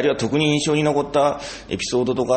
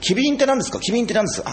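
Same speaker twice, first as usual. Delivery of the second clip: higher-pitched, repeated query; retold puzzlement; 6 semitones higher, faster than usual. Trainee asking puppeteer about jargon, puzzled and higher. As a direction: re-enact an eager, baffled question.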